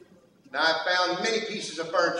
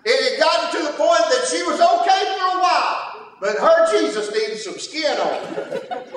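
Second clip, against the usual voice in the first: higher, louder fervent preaching; preaching at full force; 9 semitones higher, 5 dB louder than usual. Acted. Preacher exhorting the congregation about childlike faith, full-force preaching. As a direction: drive it home to the church.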